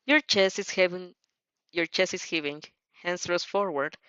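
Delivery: usual speaking voice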